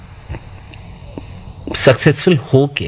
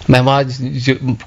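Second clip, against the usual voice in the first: normal talking voice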